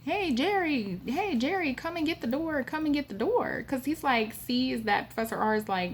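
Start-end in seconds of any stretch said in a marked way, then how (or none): 0.0-3.6 high calling for the servant; retold yell. Old host calling Jerry to lock the door, yelling. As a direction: call the man to the door, mock yelling. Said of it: "and he's yelling".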